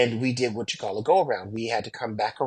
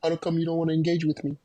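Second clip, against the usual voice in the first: faster, higher mock-whiny wife; mock wife complaint; 4 semitones higher, 3 dB quieter, much faster than usual. Husband mimicking his wife's complaint, mock whiny and quick. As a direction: imitate a wife feeling ignored. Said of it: "all of a sudden".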